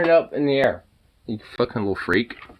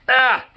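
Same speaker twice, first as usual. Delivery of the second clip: strained yelp; 11 semitones higher, 6 dB louder than usual. Real, not acted. Dog heavy, strains.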